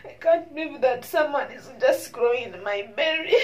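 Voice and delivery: loud, tearful disbelief; real tears of joy; crying. Real, not acted. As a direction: cry it out in wonder.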